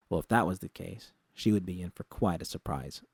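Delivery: plain reading voice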